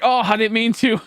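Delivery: high, fake-sorry oh; mock sorry. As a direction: play the false apology high and sweet. Fake apology again.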